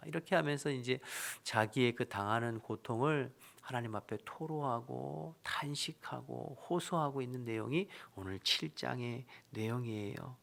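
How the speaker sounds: ordinary delivery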